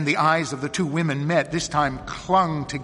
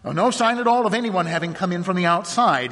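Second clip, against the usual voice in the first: higher, louder businesslike pickup; resuming a conversation; 6 semitones higher, 5 dB louder, faster than usual. A narrator's reading. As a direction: pick the discussion back up briskly. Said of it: "in the manner of continuing an interrupted conversation".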